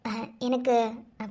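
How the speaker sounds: ordinary talk, nothing marked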